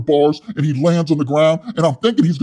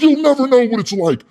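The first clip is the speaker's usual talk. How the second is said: high, loud mock yell; yelling Wes; 8 semitones higher, 5 dB louder than usual. Wes yelling at his cellmate, loud and high. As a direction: bark it at the cellmate like a hothead. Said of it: "why does he keep yelling at me".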